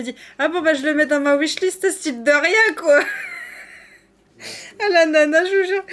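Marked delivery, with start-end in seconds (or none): 3.1-3.6 petit rire très aigu qui s'envole; lâche un rire suraigu de surprise. Real, not acted. Éclat de joie devant cadeau. 4.8-5.8 parle en riant, au bord des larmes; rire ému. Real, not acted. Émue par le cadeau de Didi.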